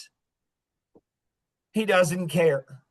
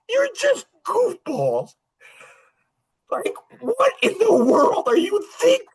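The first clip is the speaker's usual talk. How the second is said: much higher, slowed, exasperated disbelief; scolding them in high mocking disbelief; incredulous; 11 semitones higher than usual. Real, not acted. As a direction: pitch way up as if you can't believe them. Pastor mocks church leaders in disbelief.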